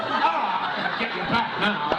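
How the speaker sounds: high-pitched mock struggle; puppet fight voice. Actor wrestling a foam puppet off screen, high hammed-up fight voice. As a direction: act out fighting a big puppet. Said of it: "I'm doing the puppet".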